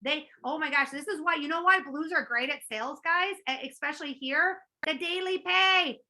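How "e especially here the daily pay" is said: ordinary talk, nothing marked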